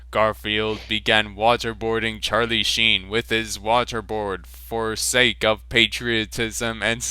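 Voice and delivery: ordinary talk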